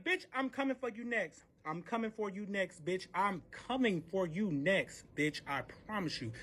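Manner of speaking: hushed, deliberate retold threat; quiet threat. Threatens the other woman.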